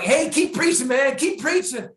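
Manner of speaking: very high, loud, slow hammed-up encouragement; mock cheering. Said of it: "I mean, I was like".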